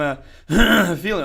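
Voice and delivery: choking on the words; clearing a choked throat mid-word. Real, not acted.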